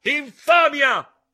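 high, slow, very loud outraged scream; furious yell. Acted. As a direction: bellow the insult with full fury. (urlo furioso, acuto e lento)

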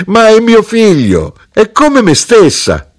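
very high, loud, slowed exclamation; amused, laughing mother. A narrator's reading. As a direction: squeal it in amused dismissal. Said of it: "Mia madre rise".

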